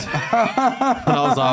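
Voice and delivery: high, laughing and slow; cracking up mid-sentence. Real, not acted. Laughing while admitting the joke.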